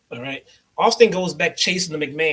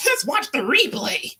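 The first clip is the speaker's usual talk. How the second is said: much higher, gleeful put-on voice; King impression; 9 semitones higher, 3 dB louder than usual. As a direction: chirp it like a gloating co-host. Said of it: "And then there's King".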